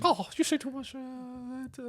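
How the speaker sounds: high, thin mock-Irish mother; badly faked high Irish accent; lame Irish accent. John's mother scolding her son, lame Irish accent. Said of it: "That's the lamest Irish accent".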